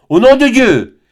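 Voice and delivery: the friar's climactic thundering repeat; thundering, even stronger. A narrator's reading. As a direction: hurl the second cry harder still. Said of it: "d'une voix tonnante".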